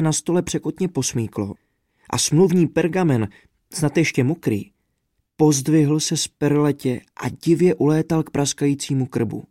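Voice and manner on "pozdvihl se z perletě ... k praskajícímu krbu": ordinary delivery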